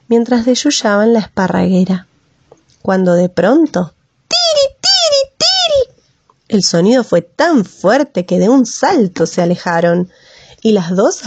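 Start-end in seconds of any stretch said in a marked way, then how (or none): 4.3-6.0 shrill, very high bird cry of alarm; tero bird call. Acted. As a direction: squeal it like a startled lapwing.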